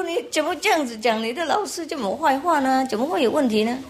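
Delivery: a touch of a shocked mother telling off; scolding mother. Retold or imitated. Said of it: "妈妈说".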